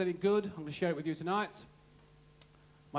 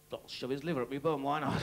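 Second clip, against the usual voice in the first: lower, fast mock inner voice; man's inner thought; 5 semitones lower, faster than usual. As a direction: mutter it like a desperate man talking himself into it. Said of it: "thinks to himself".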